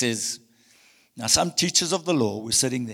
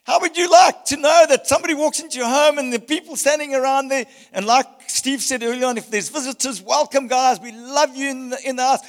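louder, building fervent preaching; climactic preaching; 9 semitones higher, 7 dB louder than usual. Acted. Pastor telling the healed man walking out, building loud preaching. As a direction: drive the story to its climax. Appeal to the congregation.

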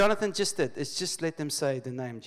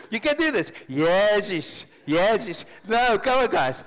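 loud, high cheerleading push; mock coaxing shouts; 6 semitones higher, 8 dB louder than usual. Acting out a preacher urging guys to join in, loud coaxing. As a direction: egg the group on at full voice. Acts out urging a reluctant group.

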